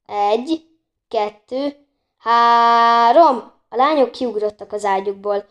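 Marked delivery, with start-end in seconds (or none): 0.1-3.5 slow count building to a loud, drawn-out three; slow countdown. A narrator's reading. Bori counting the girls out of the room, slow countdown. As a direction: count slowly, then launch the last number. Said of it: "lassan számolni kezdett".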